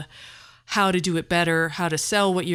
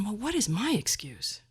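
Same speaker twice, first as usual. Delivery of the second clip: 1 quiet inner thought; 7 dB quieter than usual. Retold or imitated. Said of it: "I'm thinking".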